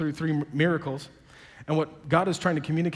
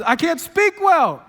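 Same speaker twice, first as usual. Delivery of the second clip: much higher, louder, slow whine; whiny Moses; 10 semitones higher, 8 dB louder, slower than usual. Retold or imitated. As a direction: Moses whining to God in a loud, stretched voice. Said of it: "Moses says".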